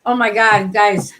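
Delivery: ordinary delivery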